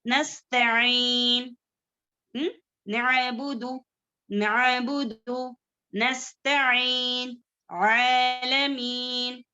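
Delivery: very slow, stretched ayn in नस्तईन, नअबुदु, आलमीन; ayn articulated. Shown as a demonstration. Teacher modelling recitation words for students, ayn held long. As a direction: draw out each throat ayn in नस्तईन and आलमीन. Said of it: "इन दोनों की आवाज अलग है".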